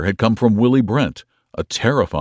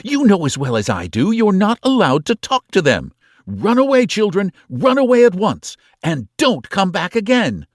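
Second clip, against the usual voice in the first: loud, high outraged aunt ordering; angry aunt sending children away; furious scolding; 12 semitones higher, 5 dB louder than usual. A narrator's reading. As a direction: drive them out with cold anger. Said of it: "her cold, furious voice".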